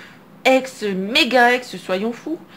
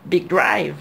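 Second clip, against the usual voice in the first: "Big drive" said the English way, a little lower; English pronunciation; 5 semitones lower than usual. Shown as a demonstration. Card opener translating the name into English, English accent. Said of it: "on va le faire en anglaise".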